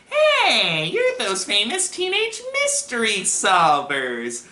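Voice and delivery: high, squeaky nerd excitement; loud, stretched-out nasal-nerd greeting; Steve Urkel. Acted. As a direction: gush like an annoying superfan. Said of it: "this squeaky, annoying voice".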